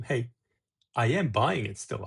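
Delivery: normal talking voice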